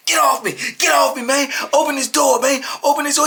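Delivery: high, loud protest; retold angry yelling. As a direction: imitate a man fighting off restraint. Acted officer struggling, shouting.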